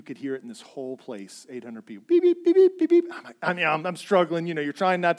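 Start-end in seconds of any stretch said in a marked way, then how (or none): 2.1-3.0 high, loud imitated pager beeps; pager beeping. Pager going off in church, loud mimicked beeping. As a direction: mimic the electronic pager alarm. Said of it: "my pager starts to go off".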